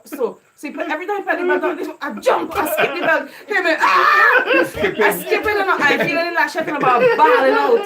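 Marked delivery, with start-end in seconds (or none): 3.5-4.4 laughing mock cry of pain, loud and high; retold yell of pain. Retelling his childhood yelp when the belt lashed, a laughing mock cry. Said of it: "I'm balling out to the top of my voice".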